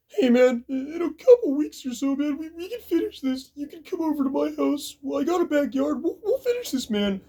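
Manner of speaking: hammed-up weepy challenge; mock bawling plea. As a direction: beg for a rematch while sobbing. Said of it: "this guy's bawling his eyes out".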